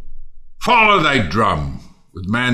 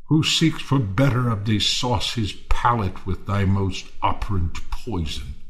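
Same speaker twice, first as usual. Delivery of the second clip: usual speaking voice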